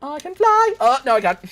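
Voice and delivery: high, loud cry that drops into dismay; excited then dismayed. Real, not acted. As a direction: shout the boast high, then catch yourself. Falling in game.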